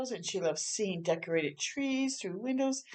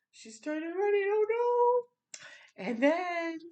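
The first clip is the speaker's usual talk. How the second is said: high, loud oh no; dramatic alarm; 11 semitones higher, 4 dB louder than usual. A narrator's reading. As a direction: gasp it out for the kids.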